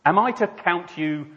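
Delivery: accusing God, weighty and emphatic; voice from the sky. Acted. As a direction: confront the sinner from on high. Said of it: "a voice came out of the sky".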